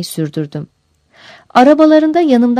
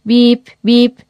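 higher, level-pitched beeps; spoken horn beeps; imitated truck horn; 4 semitones higher, 4 dB louder than usual. A narrator's reading. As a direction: honk like an oncoming truck.

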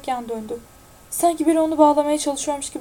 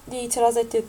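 plain reading voice

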